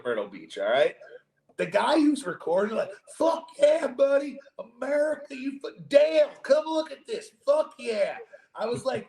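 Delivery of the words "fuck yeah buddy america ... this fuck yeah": high, loud hammed-up South Carolina drawl; hard Southern accent. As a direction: imitate the hyped-up Southern guy filming the balloon, higher and louder. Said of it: "it was the hardest accent ever".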